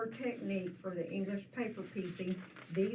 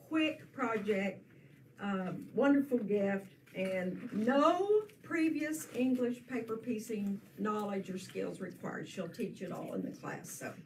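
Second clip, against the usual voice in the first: usual speaking voice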